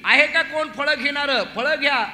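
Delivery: high, loud hawker's call; loud vendor's cry. Acted. Fruit seller outside Nanda's door, calling out loudly. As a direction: call out for any buyers. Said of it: "मोठ्याने ओरडायला लागली".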